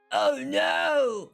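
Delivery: high scared cry; frightened cry. Acted. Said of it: "Carly Beth cried".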